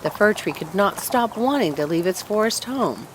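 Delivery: ordinary delivery, nothing marked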